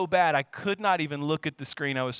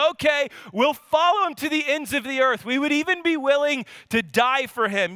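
eager rallying cry; 8 semitones higher, 3 dB louder than usual. Acted. Crowd rallying behind Jesus.

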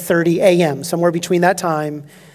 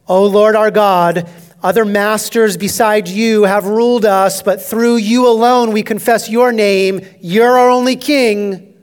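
quoted prayer; 4 semitones higher, 6 dB louder than usual. Acted. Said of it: "They revoke Isaiah twenty-six".

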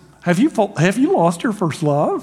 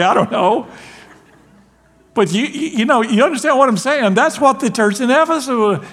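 ordinary talk, nothing marked

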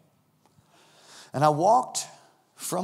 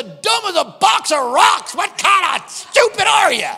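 high, loud scolding; furious executive yelling at the young preacher; angry rant; 8 semitones higher, 5 dB louder than usual. Retold or imitated. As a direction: berate him like a fool. Executive berating him.

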